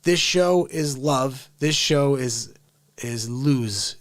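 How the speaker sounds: said slowly and carefully; slow clear delivery for a foreign viewer. Shown as a demonstration. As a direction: spell it out slowly so they understand. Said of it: "I'll say this slow".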